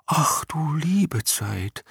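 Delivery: gehaucht, sehr langsam, hoch und ratlos. A narrator's reading. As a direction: fassungslos seufzen, ganz langsam geflüstert. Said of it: "meinte Jicher ratlos". (langsam geflüstert, hoch und ratlos)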